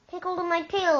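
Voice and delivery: high and loud, urgent; shouted order. Retold or imitated. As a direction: shout it as you pull them in. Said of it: "I shouted".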